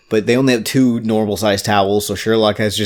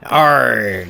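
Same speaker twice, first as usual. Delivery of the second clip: put-on pirate accent. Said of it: "pirate accent".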